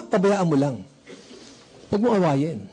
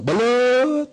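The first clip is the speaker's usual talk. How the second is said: vendor's call; 5 semitones higher than usual. Retold or imitated.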